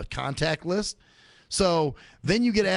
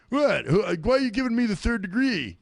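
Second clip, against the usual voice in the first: a higher, louder put-on indignant reply; mock indignant; 7 semitones higher, 3 dB louder than usual. Imitating a stranger snapping back at being questioned, higher and louder. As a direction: play the offended stranger, huffy. Quoting annoyed group texters.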